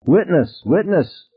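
high, gleeful call; merrily cried. A narrator's reading. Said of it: "Grace cried merrily".

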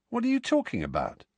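baffled quick question; confused Ron. Acted.

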